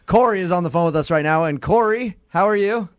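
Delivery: ordinary talk, nothing marked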